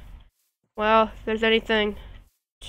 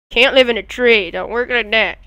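ordinary delivery

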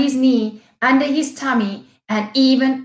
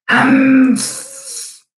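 loud, sulky puff of breath; grumpy humph; 2 semitones higher, 5 dB louder than usual. A narrator's reading. Rhino grudgingly accepting Tickbird's offer, loud sulky humph. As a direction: huff it out, grudging. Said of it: "puffed Rhino".

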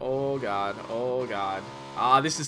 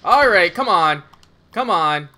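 scared horror game streamer; 4 semitones lower than usual. Real, not acted. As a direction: yell in fright, urging. Chased by vehicle, panics.

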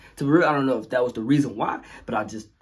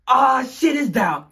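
slow, high, loud call; drawn out alarmed cry; wrong words; 11 semitones higher, 7 dB louder, much slower than usual. Retold or imitated. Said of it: "all you hear is".

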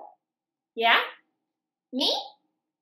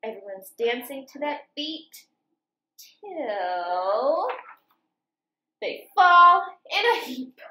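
lively sing-song picture book reading; reading to children; 5 semitones higher, 4 dB louder, slower than usual. A narrator's reading. Teacher reading farm story to children, lively sing-song. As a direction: read it bouncy, like for little kids.

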